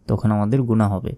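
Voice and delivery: usual speaking voice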